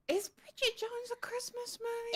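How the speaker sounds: gasp, then high-pitched disbelief; scandalised high-pitched query; incredulous question. Real, not acted. Challenges the other's film choice.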